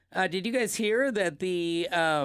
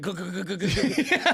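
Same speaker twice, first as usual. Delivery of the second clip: gulping sounds; 3 dB louder than usual. Pretend. Miming chugging booze while laughing.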